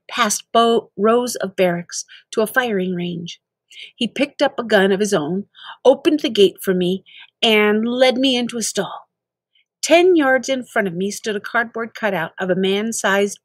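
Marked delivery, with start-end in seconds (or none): none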